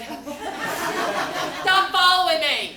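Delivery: high, loud, drawn-out scolding; hammed-up annoyed shouting; retold exasperated yell. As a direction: yell at a tagalong little brother. Yelling at tagalong brother, retold.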